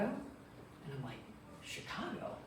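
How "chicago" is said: breathy disbelieving Chicago, pitched way up; high whispered disbelief; incredulous. Retold or imitated. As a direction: scoff at the idea in a high, breathless voice. Incredulous at psychic's prediction.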